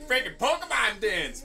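ordinary talk

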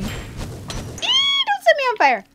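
high, drawn-out squeal into a plea; squealing alarm. Pretend. Player dodging a blaze, squealing in alarm.

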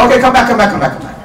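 high, loud call that drops away; calling a customer back. Acted. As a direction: call them back like a market haggler. Acted haggler calling buyer back.

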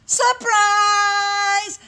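loud, high, long-held cheer; surprise shout. Acted. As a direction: burst out as a whole party would. Everybody shouts at the surprise party.

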